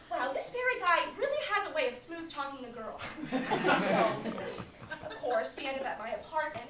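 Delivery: a woman recounting a strange night with Barry, wry and lively; Linda monologue. Acted. Actress tells audience about her night with Barry, wry and amused. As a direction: tell it like confiding a juicy story to a friend. Acted Talk Radio monologue.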